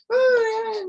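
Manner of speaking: high, loud held whine; mock childish cry of protest; child's whining wail. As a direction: whine like a kid refusing. Acting a child told to obey.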